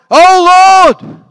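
a churchy holler that would freak people out; over-the-top holy prayer voice. Shown as a demonstration. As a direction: ham up a pious cry to heaven. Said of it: "Don't freak 'em out".

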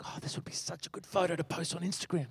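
very soft, wistful murmur; hushed aside. Retold or imitated. Preacher muttering to himself about a photo, hushed.

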